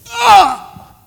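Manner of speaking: sharp high cry of agony; cry of pain. Acted. Victim on cross cries out.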